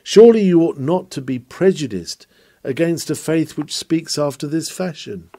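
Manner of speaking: normal talking voice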